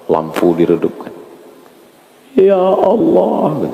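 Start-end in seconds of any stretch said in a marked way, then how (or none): 2.4-3.7 high, drawn-out plea to God; acted tearful-retreat prayer, very slow; imitated muhasabah prayer. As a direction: stretch it into a theatrical supplication. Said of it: "Bagian muhasabah".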